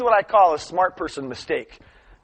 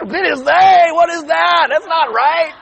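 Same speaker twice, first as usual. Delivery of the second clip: loud, high put-on mockery; mocking jeer; 13 semitones higher, 11 dB louder, slower than usual. Party guests making fun of her grammar, loud mocking. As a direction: jeer it back in an exaggerated shout. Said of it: "they all started making fun of her".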